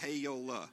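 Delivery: "Heyola" stretched into three syllables, hey-o-la; three-syllable hell. Retold or imitated. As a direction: drawl "Heyola" out like an old-time hellfire preacher. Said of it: "Three syllables".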